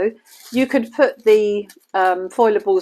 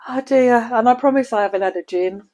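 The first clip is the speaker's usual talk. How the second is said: usual speaking voice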